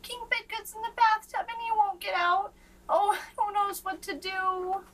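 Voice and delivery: put-on young page fretting about the king; worried page. A narrator's reading. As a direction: plead for help, anxious and high. Page's worried plea.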